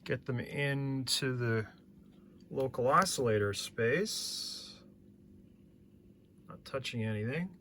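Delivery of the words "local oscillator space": normal talking voice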